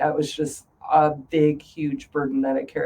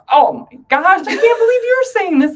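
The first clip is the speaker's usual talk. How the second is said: high-pitched, louder indignant cry; hammed-up shock at being betrayed; mock outrage; 9 semitones higher, 7 dB louder than usual. Retelling her reaction to her husband, mock outraged. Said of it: "I'm like".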